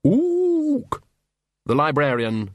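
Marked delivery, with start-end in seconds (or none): 0.0-1.0 very high, long hoot; piercingly high ape plea; insistent ape. A narrator's reading. Librarian ignored by Vimes pushes harder, insistent.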